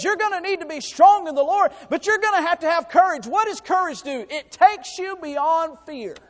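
loud, high, rising preacher's cry; preaching at full force. Acted. Preacher urging the church to courage, full force. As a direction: drive the point home to the congregation.

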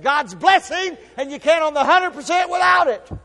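loud, high preaching building to a shout; fiery sermon climax; preaching at full force. Acted.

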